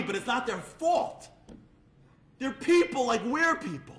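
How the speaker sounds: high, loud, stretched-out pleading; impassioned plea. Acted. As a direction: cry out for those nobody notices. Impassioned plea for overlooked children.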